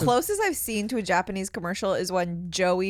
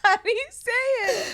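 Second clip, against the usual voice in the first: laughing; slower than usual. Real, not acted. Mock pleading question.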